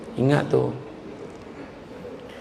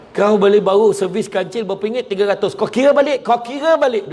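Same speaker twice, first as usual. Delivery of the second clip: high, loud indignant complaint; angry customer; 6 semitones higher, 3 dB louder than usual. Acted. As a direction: demand they recount, annoyed. Acted angry customer at service. (pelanggan marah, suara tinggi)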